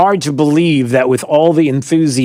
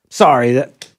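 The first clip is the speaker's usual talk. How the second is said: ordinary delivery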